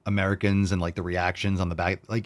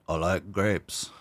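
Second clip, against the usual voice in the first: slow, drawn-out, softer silly voice; 5 dB quieter, much slower than usual. Pretend. Streamer echoing himself about grapes, slow silly voice. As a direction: draw out each word in a put-on voice.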